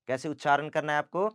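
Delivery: usual speaking voice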